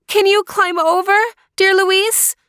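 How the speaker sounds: put-on high, pleading young girl voice; high young sister pleading; girl calling out. A narrator's reading. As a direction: call to your brother through the wall. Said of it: "asked Natalia".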